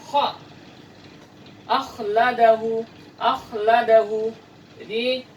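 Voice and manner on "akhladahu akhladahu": "Akhladahu" slow and louder, kh rasped from the throat; Arabic kha pronunciation; throaty Arabic kha. Shown as a demonstration. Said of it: "huruf kha harus bersumber dari dalam tenggorokan".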